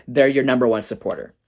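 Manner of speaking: usual speaking voice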